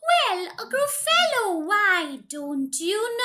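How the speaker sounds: usual speaking voice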